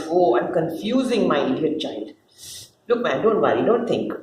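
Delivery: usual speaking voice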